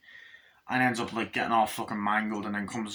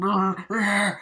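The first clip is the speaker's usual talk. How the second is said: mock inflating scream; 10 semitones higher, 5 dB louder than usual. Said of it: "his head's just going".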